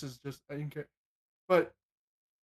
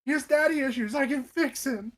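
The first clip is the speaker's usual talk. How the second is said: high, louder parody of a smitten rescuer; mocking imitation; 8 semitones higher, 3 dB louder than usual. Player mocking friend who pitied the son, high teasing imitation. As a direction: echo them in a raised, teasing voice. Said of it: "All I'm hearing is".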